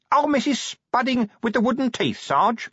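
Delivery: very high underling's voice; eager corporal. Acted. Corporal recognising the cleaner, high and eager. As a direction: pipe up in recognition. Figure of Nobby speaks.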